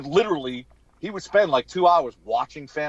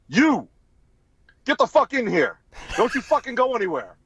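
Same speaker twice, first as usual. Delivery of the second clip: retold scream; 8 semitones higher than usual. Basketball star screaming at Seth MacFarlane to stay. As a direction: bark it at him like a big angry man. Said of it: "And he's screaming at Seth MacFarlane".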